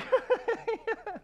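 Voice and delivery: put-on high, girlish giggle done as the wife; imitated bashful giggle; mock giggle from wife. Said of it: "And she's like".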